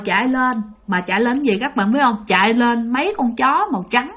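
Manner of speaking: usual speaking voice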